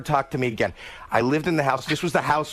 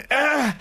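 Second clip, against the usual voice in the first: high, louder playful "Ah" going up; swing whoop; 12 semitones higher, 3 dB louder than usual. Retold or imitated. Dad on a swing with his little girl, whooping high. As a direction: whoop like you're soaring on a swing.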